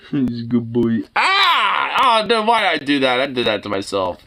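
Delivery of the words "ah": short high loud yelp; startled yelp. Real, not acted. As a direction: yelp as the dog lunges. Dog lunges at face.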